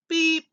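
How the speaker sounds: high, loud little beep; short high horn toot; truck beep. A narrator's reading. Blue greets a big green toad, high beep. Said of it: "said Blue to a big green toad".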